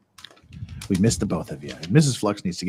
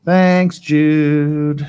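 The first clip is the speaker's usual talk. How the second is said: higher, louder mocking echo of Thanks, Jude; mock thank-you; 4 semitones higher, 10 dB louder than usual. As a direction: parrot it back in a high, loud mocking voice.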